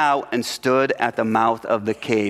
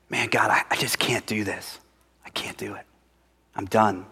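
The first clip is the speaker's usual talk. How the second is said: retold prayer in a much softer unvoiced whisper; despairing whisper; 3 semitones lower, 6 dB quieter than usual. Young minister telling God he quits, whispered. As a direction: breathe out defeat, barely audible. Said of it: "I said".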